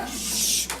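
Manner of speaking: ordinary talk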